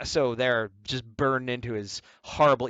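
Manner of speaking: usual speaking voice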